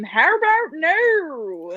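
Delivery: high, drawn-out scream of refusal; mock scream. Cohost showing how they react when they disagree, mock scream. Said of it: "and I just scream".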